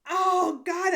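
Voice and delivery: ordinary talk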